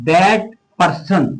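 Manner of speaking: that person drawn out very slowly; slow demo. Shown as a demonstration. Teacher slowly reading the example to students. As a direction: dictate the example word by word. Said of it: "देखते हैं example से".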